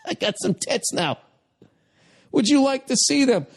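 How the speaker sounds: high-pitched teasing offer; mock proud dad. Voices the dad showing off.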